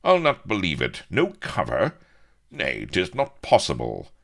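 loud, high, aghast protest; indignant disbelief from the hero; incredulous. A narrator's reading. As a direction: refuse to believe it, voice leaping up. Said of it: "Avery increduled".

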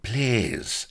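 butter them up; ingratiating plea. A narrator's reading. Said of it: "added Blood ingratiatingly".

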